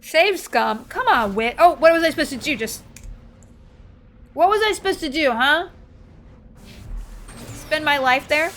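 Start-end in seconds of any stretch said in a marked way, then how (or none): 1.6-8.5 loud, high, defensive complaint; exasperated self-defence; indignant protest. Real, not acted. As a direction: protest loudly, demanding an answer. Defensive complaint after reloading.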